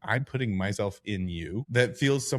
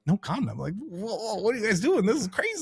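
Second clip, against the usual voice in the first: much higher, shocked disbelief voice; incredulous squeal; 11 semitones higher than usual. Retold or imitated. Podcast host imagining fictional lovers skipping condoms, high shocked squeal. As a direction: squeak it out like you can't believe it. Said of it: "In my head, I'm like".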